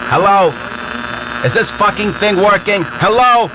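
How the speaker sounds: high calling hello into a mic. Real, not acted. Mic check, nobody hears him.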